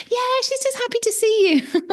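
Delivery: high, chirpy owner impression; mimicking owner. Runner imitating the cheerful owner, high chirpy mimicry. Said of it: "she was like".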